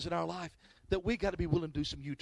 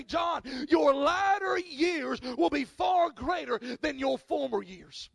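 slow, emphatic pronouncement, called out loud; voice of God; 6 semitones higher, 3 dB louder, much slower than usual. Retold or imitated. Pastor recalling God's words in the car, slow and solemn. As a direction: proclaim it slowly like a promise from above. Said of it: "I felt in my spirit the Lord say".

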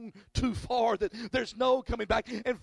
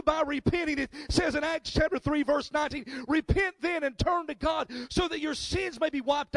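preaching at full force; 4 semitones higher than usual. Acted. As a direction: preach it out to the whole room.

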